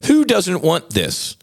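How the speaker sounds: drawn-out mocking question; high sarcastic challenge; indignant. Real, not acted. Rhetorical indignation.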